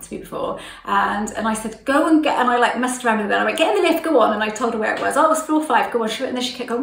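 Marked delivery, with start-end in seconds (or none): 1.8-2.4 flustered shooing. Retold or imitated. Flustered shooing woman into lift. 3.6-4.3 loud, higher shoo into the lift; louder, higher get-in-the-lift push; flustered shooing. Retold or imitated. 5.1-6.1 flustered directions. Retold or imitated.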